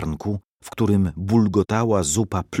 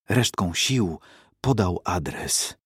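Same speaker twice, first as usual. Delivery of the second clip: ordinary delivery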